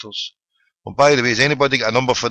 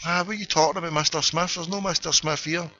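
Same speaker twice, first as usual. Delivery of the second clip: higher, softer baffled voice; mildly baffled, pitched up and quieter; puzzled reply; 6 semitones higher, 6 dB quieter than usual. Retold or imitated. Acted phone call, puzzled reply.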